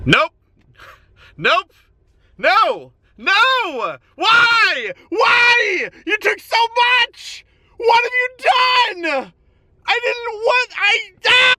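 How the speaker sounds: rising from a stunned no to high wailing shouts; anguished shouting. Real, not acted. Real horror at new haircut.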